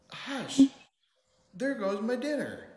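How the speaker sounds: high, loud yelp of complaint; storybook yell. A narrator's reading. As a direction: yell it like an indignant cat. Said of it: "Gus yelled".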